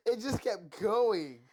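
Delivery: high, loud, laughing through words; can barely talk for laughing. Real, not acted.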